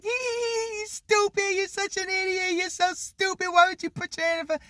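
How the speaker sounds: high taunting giggle, then jeering; mocking big brother. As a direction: cackle at the little sister's pain. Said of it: "laughing at me".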